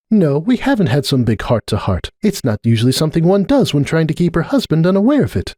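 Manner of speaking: a hint of a prickly older mare; Cupcake. A narrator's reading. Cupcake brushing off Starlight, tart.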